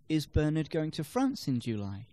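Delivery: "France" lifted above the other words; stress on FRANCE. Shown as a demonstration. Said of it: "can place the stress on different words".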